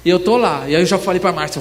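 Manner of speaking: usual speaking voice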